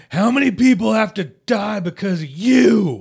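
high, loud anguished accusation; hammed-up movie-hero yelling; all-out dramatic outburst. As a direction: overact it at full shout, accusing him. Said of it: "giving it his all".

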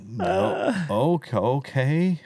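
slow, laughing acceptance; laughing brush-off. Retold or imitated. Snubbed newcomer laughing it off, slow. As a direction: laugh off the snub. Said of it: "And I'm like".

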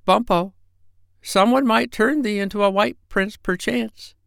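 put-on small high little-girl voice; tiny tinkling fairy voice. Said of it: "small, high voice like a little girl".

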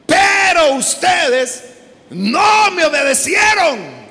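loud, slow booming proclamation; preaching at full force. Acted. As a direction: thunder out the Lord's words. Preacher proclaims God's words. (proclamación lenta y fuerte, a gritos)

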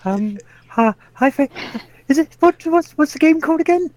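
awkward streamer. Retold or imitated. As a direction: stumble through it like a nervous streamer. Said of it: "this falls into the awkward streamer problem".

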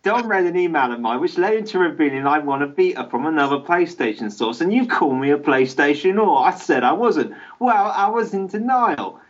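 mock British accent with a closing innit; British chap accent. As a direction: read the email as a proper British chap. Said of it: "I'm reading it like a British chap".

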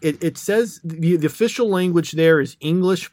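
ordinary talk

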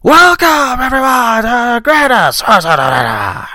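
high, loud, slow announcer shout; Vince McMahon impression. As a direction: boom it like a wrestling chairman. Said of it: "Vince McMahon when he's like".

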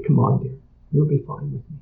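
calm her down like a nervous patient; reassuring softly. Retold or imitated. Coaxing a scared volunteer.

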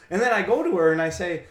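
ordinary delivery

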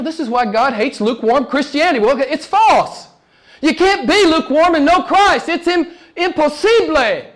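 high-pitched, rising sermon denunciation; fervent preaching. Real, not acted. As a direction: preach with mounting urgency. Preacher denounces lukewarm faith.

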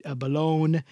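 put-on crooning old Italian charmer, laying it on thick; Mister Del Rossi. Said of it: "I gotta get the old man in here".

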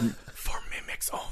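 whispered luxury ad tagline; breathy perfume ad voice; perfume commercial whisper. Pretend. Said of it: "mimic perfume commercial".

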